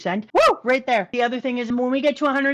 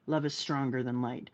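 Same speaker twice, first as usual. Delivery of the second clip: low soft Sanji; 9 semitones lower, 12 dB quieter than usual. Retold or imitated. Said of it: "And then Sanji".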